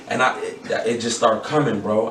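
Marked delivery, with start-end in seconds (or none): none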